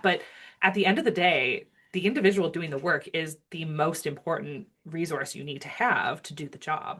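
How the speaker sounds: usual speaking voice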